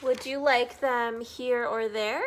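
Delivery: loud, coaxing question; Sam character voice. Acted. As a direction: tempt them cheerfully. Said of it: "read you guys Green Eggs and Ham".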